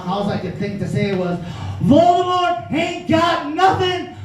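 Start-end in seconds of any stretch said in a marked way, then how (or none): 1.8-4.2 slow, high, swaggering movie-star drawl, lightly done; a hint of Denzel swagger after winning the duel; Denzel impression. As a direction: channel a cocky Denzel, stretching every word. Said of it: "My inner Denzel started to come out".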